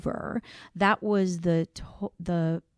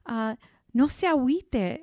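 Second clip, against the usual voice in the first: retold client's Spanish plea, lightly voiced, a little higher; Mexican Spanish quote; 7 semitones higher than usual. Retelling a farm client's Spanish words, lightly voiced. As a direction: quote the farmer's Spanish with a grin. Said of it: "I'll say it in Spanish 'cause it sounds so good".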